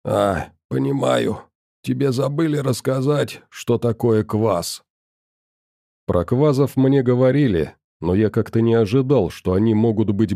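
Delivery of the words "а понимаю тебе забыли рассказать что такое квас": monster bartender voice. Acted. The kvaz bartender speaks. (медленный хриплый голос чудовища)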